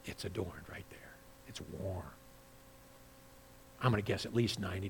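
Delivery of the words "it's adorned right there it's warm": whispered and much softer. Real, not acted. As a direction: drop to a secret whisper. Hushed aside about heaven.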